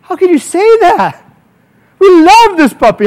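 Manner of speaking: high, slow, indignant protest; hammed-up hurt owner defending the puppy; mock outrage.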